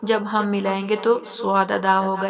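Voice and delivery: ordinary talk, nothing marked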